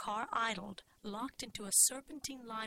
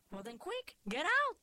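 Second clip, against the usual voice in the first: urgent Cuban mother; 9 semitones higher, 5 dB louder than usual. A narrator's reading. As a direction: voice leaps high and loud. Mother urging daughter out of car.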